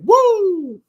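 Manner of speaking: loud high-pitched wrestler woo; Ric Flair woo. Retold or imitated. Mocking flashy garments, yells a Ric Flair woo. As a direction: throw out the signature woo again, full volume. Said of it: "They wanna be Ric Flair".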